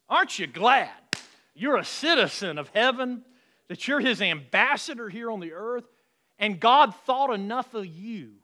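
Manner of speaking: loud, high, fervent preaching; full-force preaching. Acted. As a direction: preach it out to the whole church.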